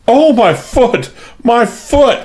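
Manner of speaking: high, slow squealing in pain; hysterical squeal. A narrator's reading. Maid squealing over her scalded foot, hysterical high squeal. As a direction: squeal out in panic. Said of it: "she squealed hysterically".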